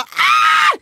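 high, loud squeal of joy; mock ecstatic yell; excited shriek. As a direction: shriek it like you can't believe it. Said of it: "Och jag skrev bara".

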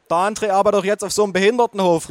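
high, loud, fast; calling mother. A narrator's reading. Mother answering for her son, calling out. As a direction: blurt it out loudly. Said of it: "rief meine Mutter".

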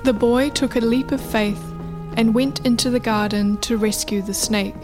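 ordinary delivery

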